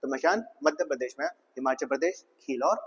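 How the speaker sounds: ordinary talk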